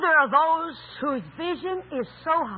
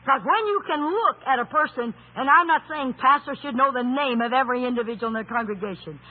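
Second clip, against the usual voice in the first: normal talking voice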